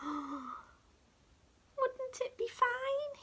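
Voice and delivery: high, slow, airy whisper; dreamy whisper. Acted. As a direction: wonder aloud in a secret high whisper. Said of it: "he murmured".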